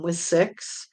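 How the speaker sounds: ordinary talk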